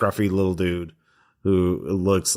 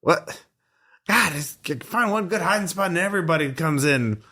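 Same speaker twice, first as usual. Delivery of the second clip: a higher, peeved vagrant complaining about his ruined hiding spot; grumpy hermit; 7 semitones higher than usual. Acted. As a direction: grumble as the put-upon squatter, higher than your own voice. GM voicing the hermit.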